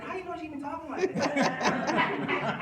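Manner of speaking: high, slow laughing tease. Retold or imitated. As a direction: tease his wife, cracking up. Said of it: "and I said".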